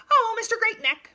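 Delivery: high, loud call to the giraffe. A narrator's reading. Hornbill calling up to the passing giraffe, high loud call. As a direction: hail him from below. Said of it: "she called".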